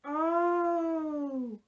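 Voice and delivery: ordinary talk, nothing marked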